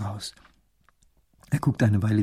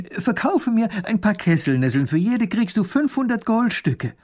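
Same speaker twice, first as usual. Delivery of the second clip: little grand duke; 9 semitones higher, 4 dB louder than usual. Acted.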